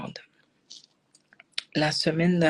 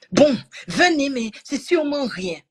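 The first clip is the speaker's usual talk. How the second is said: aigu et fort, ton de médecin blasé; médecin condescendant imité; 8 semitones higher, 4 dB louder than usual. Retold or imitated. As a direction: singer le médecin qui minimise. (imitation aiguë et forte du médecin)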